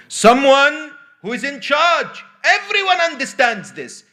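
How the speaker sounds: high, loud, insistent correction; emphatic indignation. Acted. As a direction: hammer it home as an exasperated head teacher. Acted head teacher insisting on meaning.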